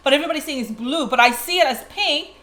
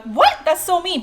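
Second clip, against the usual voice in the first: offended listener; 7 semitones higher, 4 dB louder than usual. Retold or imitated. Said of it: "they're like".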